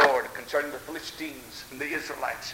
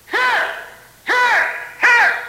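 loud high cry of here; calling out; 12 dB louder, much slower than usual. Acted. Wounded soldier calling loudly to the nurse. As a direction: call out across the field, loud and high.

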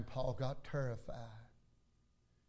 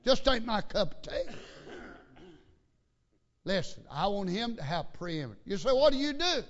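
loud, high pulpit voice; raised voice, punching key words; forceful preaching; 7 semitones higher, 6 dB louder than usual. Real, not acted. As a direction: drive it home to the congregation.

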